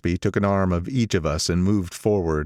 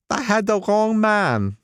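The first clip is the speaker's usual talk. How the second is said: put-on higher, nostalgic old man; old gangster voice; 3 dB louder, slower than usual. Old gangster speaking to the narrator over lunch, higher voice. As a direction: pitch him up as the old racketeer. Wolfsheim apologizes.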